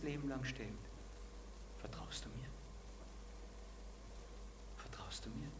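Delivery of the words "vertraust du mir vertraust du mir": soft, intimate whispered question. Acted. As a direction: whisper it like God asking gently.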